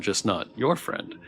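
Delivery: usual speaking voice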